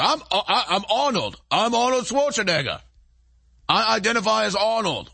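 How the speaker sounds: hammed-up Austrian strongman accent; Arnold Schwarzenegger. Pretend criminal saying who he is, mock Austrian accent. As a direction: put on a bad Austrian action-star accent. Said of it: "let's say I speak in a accent like".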